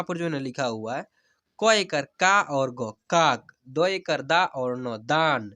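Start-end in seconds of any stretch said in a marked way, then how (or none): 1.6-5.5 very slow teacher's demo, long stretched vowels; slow spelling-out. Shown as a demonstration. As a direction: spell each letter slowly, then draw out the whole word. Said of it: "जैसे मैं हिजे करा कर पढ़ रहा हूँ". (धीरे-धीरे हिज्जे करके, स्वर खींचकर)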